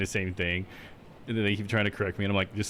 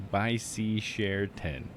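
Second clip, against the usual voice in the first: very slow, word-by-word reading; sounding it out; much slower than usual. Shown as a demonstration. Streamer testing what chat's phrase sounds like, slowly. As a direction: stretch out each word at normal volume.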